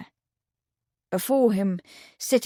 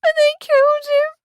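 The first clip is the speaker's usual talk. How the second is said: very high, loud anguished cry; high, loud breaking outcry; voice rising; 9 dB louder than usual. Acted. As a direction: let the grief burst out. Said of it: "Her voice rose".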